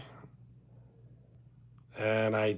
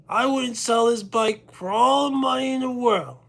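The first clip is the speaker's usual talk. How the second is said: Pee Wee voice; 11 semitones higher, 4 dB louder than usual. Acted. Quoting Pee Wee's bike line.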